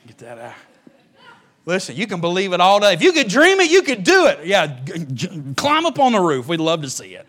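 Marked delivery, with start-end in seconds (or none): 1.7-7.2 loud, higher sarcastic preaching; loud mocking. Preacher teasing believers who think they can fly, loud mockery. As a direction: taunt them to climb the roof.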